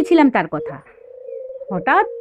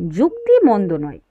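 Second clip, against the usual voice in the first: ordinary delivery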